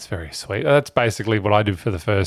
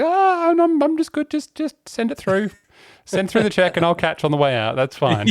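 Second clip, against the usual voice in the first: flustered refusal; 9 semitones higher than usual. Retold or imitated. Retelling his flustered refusal.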